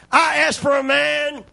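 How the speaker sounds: put-on sneering Goliath bellows at the boy; mocking giant. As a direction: yell it down at David like a scornful giant. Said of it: "what he told David".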